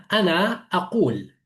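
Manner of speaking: fusha "ana aqul", q from the back of the throat; classical Arabic. Shown as a demonstration. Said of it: "en fusha es".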